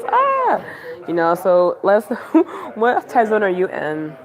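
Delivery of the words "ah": high, loud playful "ah!" of panic; mock scream. Streamer frustrated at being three hours behind, mock scream. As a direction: let out a squealed mock scream.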